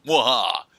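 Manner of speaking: mock evil villain laugh; a short hammy villain cackle; mustache twirling villain laugh. Gloating after casting creature.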